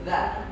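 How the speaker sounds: "Za" said thin and light. Shown as a demonstration.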